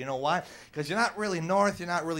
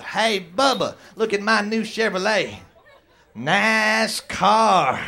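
hammed-up Southern hillbilly accent, higher pitched; hillbilly drawl; 4 semitones higher, 4 dB louder, slower than usual. Hillbilly showing off to his buddy, mock Southern drawl. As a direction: brag in a mock Southern twang. Two hillbillies impressing each other.

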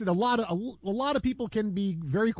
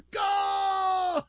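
high, drawn-out goal yell; 10 semitones higher than usual. Retold or imitated. Host imitating the fan on video, long mock goal yell. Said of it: "with his arms in the air yelling".

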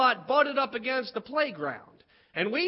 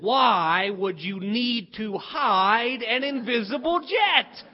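mock exasperation; 3 dB louder, slower than usual. Children's acted argument, Alicia exasperated.

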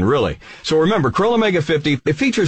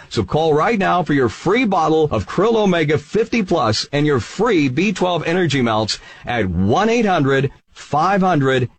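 usual speaking voice

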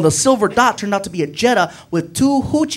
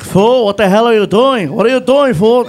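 yelling friend; 4 semitones higher, 6 dB louder than usual. Retold or imitated. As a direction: yell at him from the back seat. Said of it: "Felipe is yelling at me".

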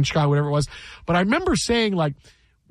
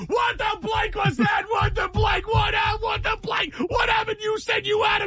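high shrieking rage at staff; furious shout; 14 semitones higher than usual. Retold or imitated. Producer screaming in control room.

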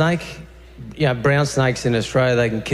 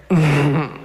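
sarcastic thanks; slower than usual. Retold or imitated. Sarcastic reply to the lady.

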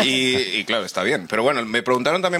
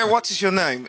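a crisp British "What is your name?", pitched up; British accent; 9 semitones higher, slower than usual. Shown as a demonstration. Showing the audience a British version, in a British accent. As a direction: sound properly British. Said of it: "es británico".

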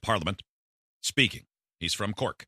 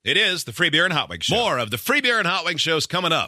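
booming promo voice; big rock station promo; radio announcer; 4 semitones higher than usual. Acted.

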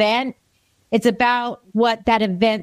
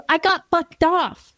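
put-on slow, higher little kid voice; five-year-old niece; 5 semitones higher, slower than usual. Niece reporting a riding mishap, slow little kid voice. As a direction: lightly mimic a small child's slow announcement. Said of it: "little niece. She's, she just turned five".

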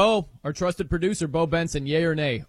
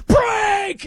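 sudden high-pitched yell of one word; imitated yell; 4 dB louder than usual. Host mimics colleague yelling for the ad break, sudden high yell. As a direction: yell it like an alarm in a colleague's ear. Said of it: "uh, calmly yell".

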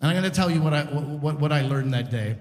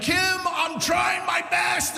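high, drawn-out imitated yell; mock yelling; 11 semitones higher, much slower than usual. Man in a domestic squabble yells at Kim, high drawn-out mock yell. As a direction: stretch it out like a man hollering in a street squabble. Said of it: "a man and a woman yelling".

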